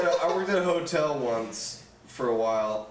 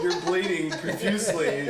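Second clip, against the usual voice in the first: put-on British accent; 4 semitones higher than usual. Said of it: "try to maintain this British accent".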